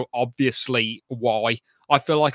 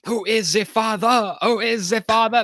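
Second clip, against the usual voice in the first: hammed-up French accent; 10 semitones higher, 4 dB louder than usual. Host mocking the actors' French accents, hammed up. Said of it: "sounded really, really hammed up".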